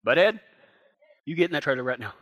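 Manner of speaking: a lightly acted cowboy losing patience; stern talk to horse. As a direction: mutter at the horse to get in the trailer. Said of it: "That's pretty good, wasn't it?".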